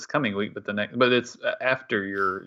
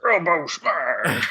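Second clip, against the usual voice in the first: announcer call; 4 semitones higher, much slower than usual. Pretend. Wrestling banter, slow announcer call.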